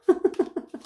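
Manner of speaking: short high laugh at herself; self-mocking chuckle; brief laugh. Real, not acted. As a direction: laugh after admitting she's boring herself.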